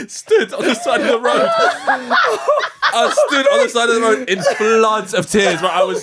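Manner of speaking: loud helpless laughter through the words; can barely get it out for laughing; laughing hard. Real, not acted. Laughing retelling fox tragedy.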